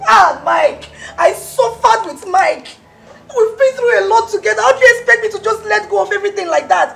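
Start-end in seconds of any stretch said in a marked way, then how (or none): 0.1-4.7 wailing lament. Acted. Ex wailing to the maid about suffering with Mike, crying. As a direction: wail out your grief.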